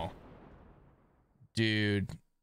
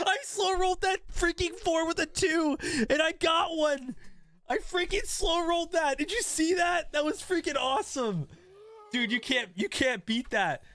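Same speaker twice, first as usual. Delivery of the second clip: excited. Real, not acted. Celebrating lucky roll.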